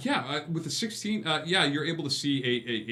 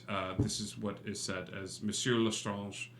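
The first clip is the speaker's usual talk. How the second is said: usual speaking voice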